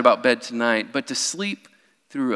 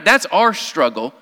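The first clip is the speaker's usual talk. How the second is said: ordinary talk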